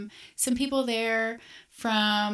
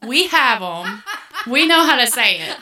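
louder, firm and laughing; firm retort; 7 dB louder than usual. Retold or imitated. Telling northerners on a cruise how to say hen, firm and laughing. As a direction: put them in their place about hen, half laughing. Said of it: "and finally I said".

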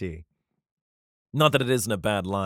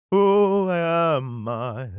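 very slow, loud, higher wail; drawn-out question; 6 semitones higher, 6 dB louder, much slower than usual. Acted. King David before the Lord, slow exaggerated questioning. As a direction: stretch every word grandly.

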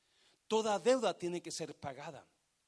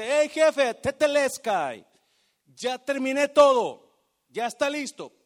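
loud, triumphant call to the boss; worker calling to boss; 8 semitones higher, 15 dB louder than usual. Retold or imitated. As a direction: shout it out proudly, job done. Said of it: "usted va y le dice".

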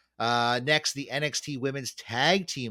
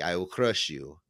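light low Russian villain growl; a hint of a cold Russian boxer; Ivan Drago; 6 semitones lower than usual. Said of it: "it's the guy from Rocky".

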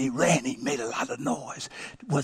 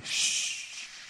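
airy, drawn-out "shh"; shushing; 7 dB quieter than usual. Retold or imitated. Father imitating his daughter hushing the room, shushing. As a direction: hush the whole room with one long shush. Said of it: "she'd say".

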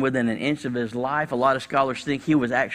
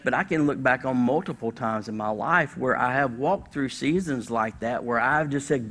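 ordinary talk, nothing marked

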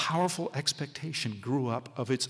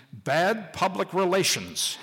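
slow and grave warning; gravely; 6 semitones higher, 5 dB louder, slower than usual. Acted. Hackelman warning the important men in the back seat, slow and grave. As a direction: lay it down slowly, like a serious threat. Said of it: "Hackelman said gravely".

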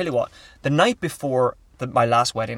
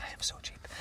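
whisper; 17 dB quieter than usual. Real, not acted. Whispered reply.

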